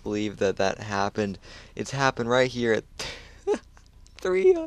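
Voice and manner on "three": squeaky high laughing, words breaking apart; can barely get words out through laughter; real giggling fit. Real, not acted. Streamer sees a huge unlock and laughs uncontrollably, high squeals. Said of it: "I don't know why I'm laughing".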